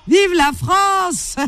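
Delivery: stretched chant-like cheer; excited cheer. Real, not acted. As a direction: sing out the cheer slowly. Celebrating on air. (acclamation chantée)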